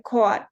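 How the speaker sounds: New York style "cawt" for CAUGHT; East Coast vowel. Shown as a demonstration. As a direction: CAUGHT with a rounded 'aw', like "cawt". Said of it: "it's gonna sound more like".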